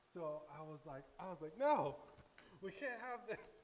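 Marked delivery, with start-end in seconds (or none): 1.6-3.6 loud, high-pitched cry of protest; emphatic objection. Retold or imitated. Speaker objects to the witch sending animals, loud protest. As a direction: burst out with a big retold refusal.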